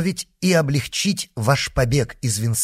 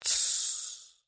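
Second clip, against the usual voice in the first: soft, voiceless "shh"; low-volume hissed shushing; hushed shush; 14 dB quieter than usual. A narrator's reading. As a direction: hush him very quietly. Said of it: "произнёс вполголоса".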